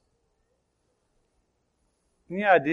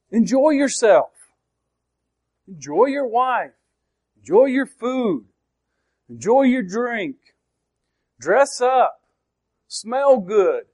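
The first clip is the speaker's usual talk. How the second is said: raised pitch, each item its own short burst; emphatic list; 6 semitones higher, slower than usual. Shown as a demonstration. Preacher lists the themes of Ecclesiastes, emphatic. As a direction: punch out each phrase high, falling at the end, with pauses between.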